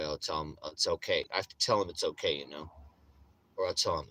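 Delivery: normal talking voice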